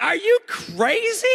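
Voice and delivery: very high-pitched mock disbelief; incredulous outburst. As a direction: exclaim it like an appalled dad, hammed up. Acting his incredulous reply to boys.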